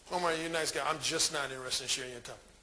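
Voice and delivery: calm rejection. Shown as a demonstration. Woman sincerely turning down Omar, calm low demonstration. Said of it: "rejects me in a calm manner".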